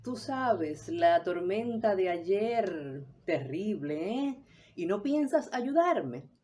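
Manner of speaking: fish for help, stretching the words, then rise into a reproach; roundabout hint for help. Shown as a demonstration. Teacher demonstrating an unclear request for help, roundabout and reproachful. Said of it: "no es lo mismo que yo te diga".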